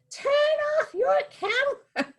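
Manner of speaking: shrill-free high British granny scolding a tourist; fussy old British guard. Retold or imitated. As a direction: squeal it like a riled-up tiny old guard. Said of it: "in a really heavy British accent".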